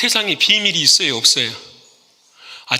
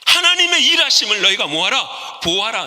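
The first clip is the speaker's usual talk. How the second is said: Moses commanding; 4 semitones higher than usual. Retold or imitated. Moses commanding the people at the sea, booming. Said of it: "백성들 향해 이렇게 말합니다".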